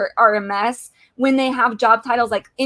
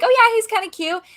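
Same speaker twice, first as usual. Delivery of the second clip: higher, half-joking gushing "Oh, yeah"; mock swoon; 10 semitones higher than usual. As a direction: imitate the group gushing over him. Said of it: "we all were like".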